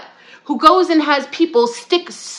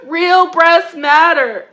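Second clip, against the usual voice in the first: very slow, laughing slogan; louder laughing chant; 5 semitones higher, 3 dB louder, much slower than usual. Real, not acted. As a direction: stretch it out while laughing.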